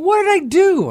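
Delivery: high, loud panicked inner thought; shocked, what went wrong; alarmed surprise. Retold or imitated. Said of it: "I'm thinking".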